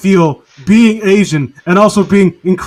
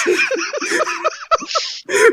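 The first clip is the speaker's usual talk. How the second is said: high, helpless burst of laughter; big laugh; 7 dB quieter than usual. Real, not acted. Retelling a funny scene, bursting into laughter. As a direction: crack up at the bandaged eyes.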